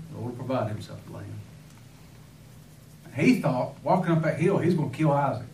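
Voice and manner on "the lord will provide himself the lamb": softer and lower, fast and tender; gentle reassurance. Acted. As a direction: reassure the boy quietly. Abraham answering his son Isaac.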